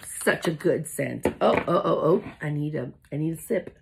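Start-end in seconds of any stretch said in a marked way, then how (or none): none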